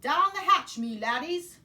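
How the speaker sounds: brinde berrado, agudo e forte; capitão gritando. Acted. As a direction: bata na mesa e grite. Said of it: "pounded his fist on the table and yelled".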